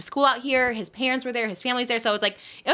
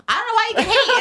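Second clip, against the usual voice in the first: high, loud retold blurt with laughter; mock dumb self; 14 semitones higher, 6 dB louder than usual. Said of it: "my dumb has to be like".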